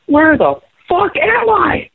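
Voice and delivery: high, drawn-out mock yell; lost-kid yell. Bassist acting out being lost at his own gig, mock lost-kid yell. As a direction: wail it slowly, like a lost child. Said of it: "I'm yelling like a little kid".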